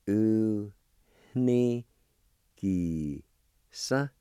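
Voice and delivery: uniqisa split as u, ni, gi, sa with a pause after each; syllable by syllable. Shown as a demonstration. Teacher breaking a Cherokee word into syllables for radio learners. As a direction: say u, ni, gi, sa as separate beats. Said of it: "It's pronounced like this".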